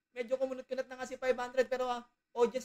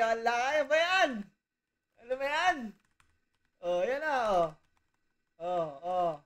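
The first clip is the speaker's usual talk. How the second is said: teasing the friend by copying how he talks; imitating Lakay; 8 dB louder than usual. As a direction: playfully mimic him, pitched up and loud. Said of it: "gayahin natin si Lakay".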